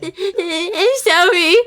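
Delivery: slow, high, trembling sorry; shaky apology. A narrator's reading. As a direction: apologize with a quivering voice. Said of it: "apologized in a shaky voice".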